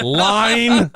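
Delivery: high, loud call for a line through laughter; mock line prompt. Host mimics stuck cohost, laughing mock line call. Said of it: "He went line".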